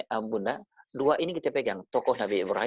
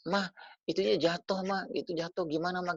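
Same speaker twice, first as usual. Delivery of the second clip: a hint of a higher child voice calling mom; lightly voiced child; 6 semitones higher, 3 dB quieter than usual. Son tells mother something fell, lightly voiced child. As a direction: play the kid calling for mama, lightly.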